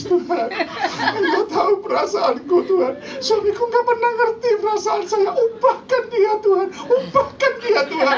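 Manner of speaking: high, hammed-up sobbing plea; mock tearful prayer. Wife praying to God to change her husband, mock crying. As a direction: act out praying through tears, pitched well up. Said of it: "Dia doa sambil nangis".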